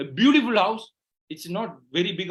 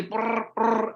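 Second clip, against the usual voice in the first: rolled, trilled frog noise; imitated frog call; frog croak imitation. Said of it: "you think relate to frog".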